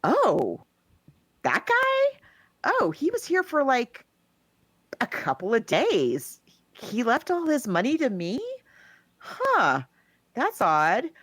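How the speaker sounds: hotel maid voice. Retold or imitated. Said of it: "And she goes".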